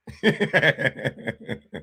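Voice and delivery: long chuckle trailing down; drawn-out run of chuckles; laughing. Real, not acted. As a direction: laugh at your own jab. Laughs at own insult.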